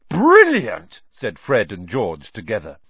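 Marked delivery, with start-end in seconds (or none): 0.1-1.0 high, loud, thrilled shout; delighted cheer. Acted. Twins cheer together.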